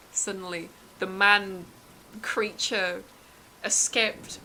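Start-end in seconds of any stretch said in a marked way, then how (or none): none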